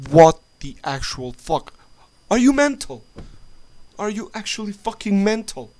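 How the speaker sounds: loud, outraged shout, the pitch leaping up on the question; furious outburst. Real, not acted. Ranting to viewers about the Syria strike, a furious shout. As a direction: yell it in real disbelief at them. Furious rant at imagined policymakers.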